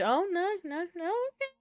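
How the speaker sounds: squeaky, rising whine of dismay; high-pitched dismay. Real, not acted. Player dismayed she liked the nickname, high whine. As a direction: let the voice climb very high in protest.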